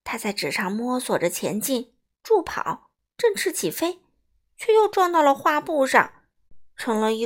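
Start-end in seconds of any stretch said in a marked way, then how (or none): none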